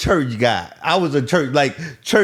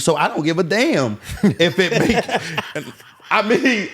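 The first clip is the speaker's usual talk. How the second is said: higher, breaking into laughter; laughing defiance; 6 semitones higher than usual. Real, not acted. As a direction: laugh through the blunt statement. Laughs while stating defiance.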